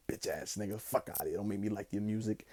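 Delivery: low, soft mock-irritated grumble. Playfully cursing at [name], low soft mock grumble. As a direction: mutter it at the rapper under your breath.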